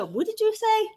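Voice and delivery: mock the husband's disbelief; shocked question. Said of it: "and he said".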